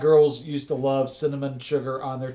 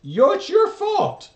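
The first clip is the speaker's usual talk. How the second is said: mock blame; 7 dB louder, slower than usual. Blaming mock outburst.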